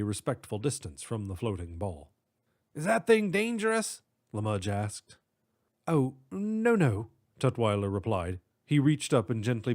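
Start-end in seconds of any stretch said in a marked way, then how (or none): 2.7-4.0 high nervous cop voice. Acted. 5.9-7.1 lightly British professor reassuring; breezy English dismissal; British accent. Acted. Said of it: "distinct British accent".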